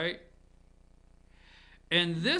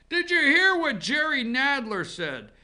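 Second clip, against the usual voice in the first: higher, louder mock excitement; mocking eager gossip; 6 semitones higher, 5 dB louder than usual. Mocking what everyone says on Monday, higher and louder. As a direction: parrot the breathless gossip, high and loud. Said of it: "everybody wants to come up on Monday and say".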